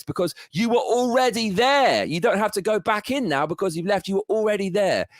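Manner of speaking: high, loud outburst of disbelief; exasperated shout. Real, not acted. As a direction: yell the obvious point at him. Angry rant peak.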